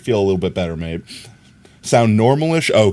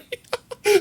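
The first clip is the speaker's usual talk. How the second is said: high squeaky giggle; a quick squeaky giggle; squeaky laugh at the viewers; 4 dB quieter than usual. Real, not acted.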